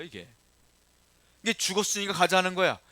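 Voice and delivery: ordinary talk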